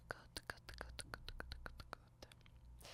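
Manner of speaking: very soft, whispered repetition; hushed, absent-minded checking-off; barely audible muttering. Acted. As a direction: murmur it to yourself, almost inaudibly. Checking items off to themselves.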